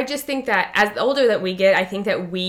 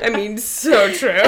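slow, louder words through laughter; real laughter; 5 dB louder, slower than usual. Real, not acted. Agreeing with a friend's teasing, laughing. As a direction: agree while still cracking up.

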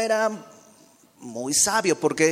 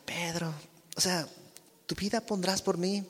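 softer, gentle question; a softer, loving "¿tu vida pondrás por mí?"; tender; 8 dB quieter than usual. Shown as a demonstration. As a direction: ask him tenderly, almost hushed. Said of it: "Sino más bien con ternura".

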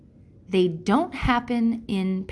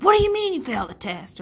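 higher, quick, incredulous scolding; exasperated parent; 7 semitones higher, 4 dB louder, faster than usual. Retold or imitated. Parent reacting to failed test, incredulous and scolding. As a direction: pounce on the kid's failure. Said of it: "we're like".

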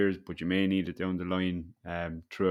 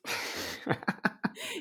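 laugh; 5 dB quieter than usual. Real, not acted. Laughing about near-death experience.

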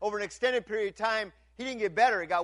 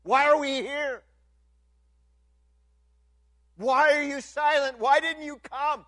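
desperate plea; 7 semitones higher, 7 dB louder than usual. Retold or imitated. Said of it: "under their breath they're saying".

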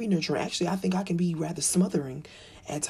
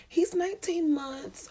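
polite small talk; 10 semitones higher, slower than usual. Retold or imitated. Other mother answering.

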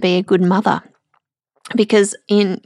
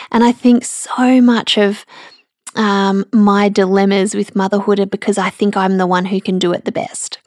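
ordinary delivery

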